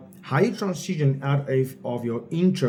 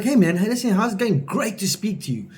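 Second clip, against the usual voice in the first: bright, higher pitched, punchy sales opener; high energy; 7 semitones higher than usual. Shown as a demonstration. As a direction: greet him like an old friend, full of energy. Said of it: "you have to be very high energy".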